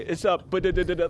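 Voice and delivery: quick ba-da-da patter of little footsteps; imitated foot patter. Describing the librarian sneaking off, quick footstep patter. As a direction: rattle off the patter of tiptoeing feet. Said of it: "the little foot patter step away".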